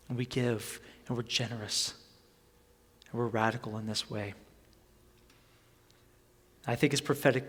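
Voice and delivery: low, soft, solemn appeal; earnest preaching. Acted. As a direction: drop low and quiet to press the point home. Quiet earnest appeal to congregation.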